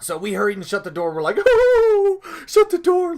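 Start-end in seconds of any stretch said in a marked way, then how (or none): 1.4-3.2 very high, loud acted panic; scared shriek. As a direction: shriek it like a frightened kid fleeing inside. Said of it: "and we were scared".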